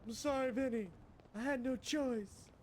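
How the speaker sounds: very high, loud squeal of apology; over-the-top drama, squeaky and loud; mock pleading betrayer. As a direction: plead in a hammed-up falsetto wail. Acts out betraying Vinny.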